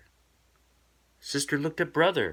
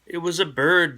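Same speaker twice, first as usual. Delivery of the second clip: eager fib; 6 semitones higher, 6 dB louder than usual. Acted. Cub blurts the lie.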